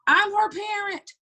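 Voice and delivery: high, drawn-out call; retold calling out. Mother calling to an officer from her car window, retold high call. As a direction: call it out long and high, as if to someone down the road.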